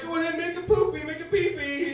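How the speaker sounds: put-on high, quick coaxing baby talk. Comic coaxing the cat to pee, high baby talk. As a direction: coax it like talking to a toddler. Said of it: "I'm like".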